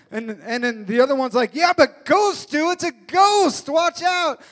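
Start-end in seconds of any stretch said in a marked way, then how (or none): 1.5-4.4 higher and much louder, panicked warning; a frightened disciple crying out on the boat; terrified disciple. Retold or imitated. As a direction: yell a warning about a ghost. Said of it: "So they're all terrified and freaking out".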